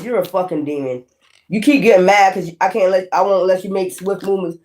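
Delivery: ordinary talk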